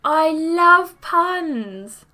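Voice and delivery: high, loud, drawn-out delight; gushing. Acted. Mikey to the girls after a pun, gushing. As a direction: gush loudly, stretching every word out.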